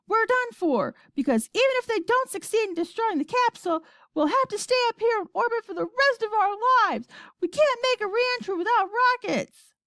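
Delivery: high, desperate rush of bad news; shouting. A narrator's reading. Astronaut explaining they are stranded, shouting. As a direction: keep pitch way up in despair. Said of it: "shouted Shanks".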